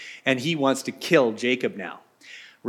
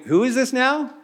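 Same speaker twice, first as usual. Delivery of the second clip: higher, puzzled question; old man caught off guard; confused Isaac; 9 semitones higher than usual. Acted. Blind Isaac hearing a second son, higher and puzzled. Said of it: "Isaac's going".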